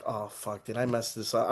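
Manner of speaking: quiet worried aside to self; hushed dread, barely voiced; I'm like. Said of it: "I'm like".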